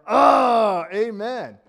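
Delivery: loud, high Oh, then a ringing amen; excited amen. Pretend. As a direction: call out amen from the pews.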